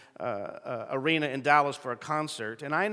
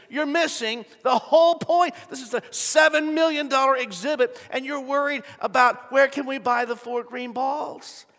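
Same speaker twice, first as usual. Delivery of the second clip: higher, louder scolding father; exasperated dad; 9 semitones higher, 6 dB louder than usual. Retold or imitated. Dad scolding kids about missing the point, exasperated. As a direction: shake your head at the kids.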